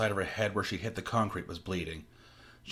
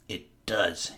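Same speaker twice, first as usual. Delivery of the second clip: usual speaking voice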